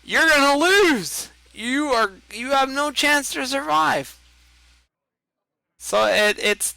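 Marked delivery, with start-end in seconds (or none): 0.0-4.2 mock doom. Mock-dramatic doom about Hearthstone.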